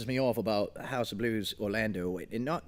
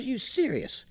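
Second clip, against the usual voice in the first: incredulous; 11 semitones higher than usual. Retold or imitated. Retelling disbelief at stage manager.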